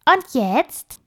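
high squeaky little animal voice; tiny bossy critter, high and quick. Acted. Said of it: "feixte es ganz nah an ihrem Ohr". (piepsige Stimme)

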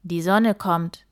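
a big creature calling over the wind. Acted. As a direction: dragon shouting back as dawn comes. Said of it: "rief der Drache zurück".

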